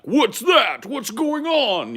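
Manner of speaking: high, loud startled cry; mock alarmed neighbors. People rushing out at a car alarm, hammed-up alarm. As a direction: call out in exaggerated alarm. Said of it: "people used to fucking come out of their houses".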